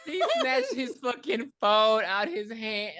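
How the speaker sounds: words broken up by laughter, slow; laughing. Real, not acted. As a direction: laugh through the whole line. Retelling funny snatch while laughing.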